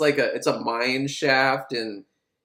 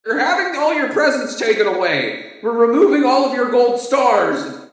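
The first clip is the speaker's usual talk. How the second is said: high, loud mock scolding like talking to kids; 8 semitones higher, 6 dB louder than usual. Prison staff announcing punishment, mock childish scolding. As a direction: scold them like a preschool teacher. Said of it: "They're like".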